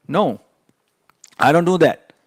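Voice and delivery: emphatic. Real, not acted. Coach rejects the loser habit, loud and firm. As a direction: slam the point home.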